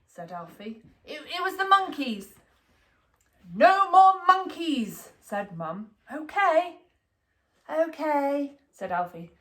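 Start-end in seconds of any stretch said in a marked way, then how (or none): 1.0-2.4 put-on stammering little boy. A narrator's reading. Child stammering excuse. 3.6-5.1 slow, firm, louder and higher; stern mum. A narrator's reading. Mum laying down the law. 6.1-8.6 put-on little boy giving in. A narrator's reading. Child giving in.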